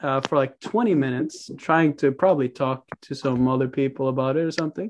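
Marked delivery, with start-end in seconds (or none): none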